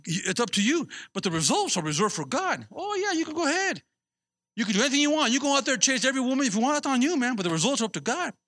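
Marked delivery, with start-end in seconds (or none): 2.7-7.4 higher, sarcastic go-ahead; sarcastic preacher daring the crowd; mock permission. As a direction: egg them on mockingly, pitched up. Mocking permission to sinners.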